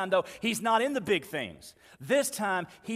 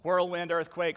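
normal talking voice

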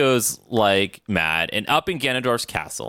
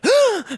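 mock gasp; 4 dB louder than usual. Mocking Mariko's gasp lines.

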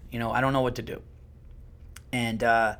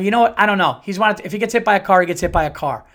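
loud, higher, fast frustrated snap; exasperated outburst; 5 semitones higher, 7 dB louder, faster than usual. Retold or imitated. Dad snapping at his kids about the runaway dog, exasperated. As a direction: blurt it out in fed-up anger. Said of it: "I go".

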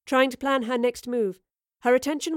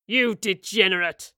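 restrained holler of disgust; contemptuous holler. A narrator's reading. Father to son shoved to the ground, contemptuous holler. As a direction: holler the insult at him. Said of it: "he hollered".

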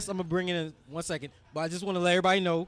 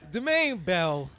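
put-on playful Jamaican accent on a name; lightly put-on Jamaican lilt; fake Jamaican accent; slower than usual. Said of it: "my fake Jamaican accent".

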